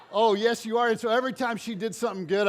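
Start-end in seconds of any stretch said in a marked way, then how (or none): 0.1-0.9 loud, laughing, higher; laughing cheer. Retold or imitated. Coach cheering on the girl with glasses, laughing loudly. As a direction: cheer her on through a laugh.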